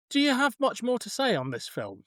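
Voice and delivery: normal talking voice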